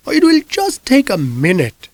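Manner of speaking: put-on high, sweetly pushy older lady; a high-pitched neighbor with a sly favor to ask; old woman voice. Mrs Lysander again.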